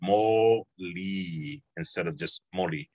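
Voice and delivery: slow "mo li" stressed, then plain "moli"; careful slow contrast of mo li and moli. Shown as a demonstration. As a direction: set stressed mo li against flat moli, slowly. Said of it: "this word should be pronounced".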